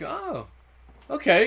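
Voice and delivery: high, startled oh, okay; mock surprise. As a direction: act caught off guard, half-joking. His own retold reaction.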